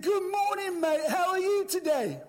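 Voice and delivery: high, bright, over-friendly hello; cheery greeting. Retold or imitated. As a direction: beam at the kid to win him over. Cheery greeting to sullen boy.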